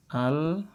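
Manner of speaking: Al-ardu recited softly; Arabic recitation. Shown as a demonstration. Teacher answering own question, soft recitation. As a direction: chant Al-ardu gently. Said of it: "Jadi bacanya gimana".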